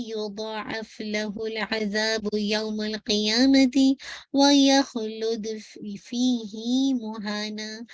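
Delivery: slow, chanted verse recitation; measured tajweed chant; Quran recitation. Acted. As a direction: recite the Arabic verse slowly and melodically.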